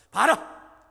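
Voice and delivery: a sudden furious yell; angry shout. Acted. Moses yelling at the grumbling people, furious. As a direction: bark it at the crowd.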